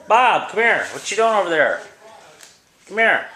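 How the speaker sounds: loud call to the cat. Real, not acted. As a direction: call him over from across the room. Calling the cat across room.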